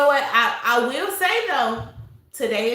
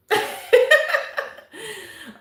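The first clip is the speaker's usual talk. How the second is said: laughing; 10 semitones higher, 3 dB louder than usual. Real, not acted. Laughing at viewer comment.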